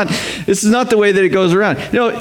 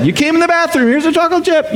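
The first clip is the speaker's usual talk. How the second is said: higher, sing-song praising parent; cheery parent; 9 semitones higher than usual. Retold or imitated. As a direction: reward the toddler brightly. Parent in potty training.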